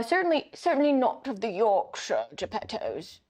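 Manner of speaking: snobbish upper-class British; British lord. Acted. Player as Lord Geppetto disowning relatives, upper-class British. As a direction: disdain in an aristocratic British accent. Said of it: "Lord Geppetto of the Berkshire Geppettos".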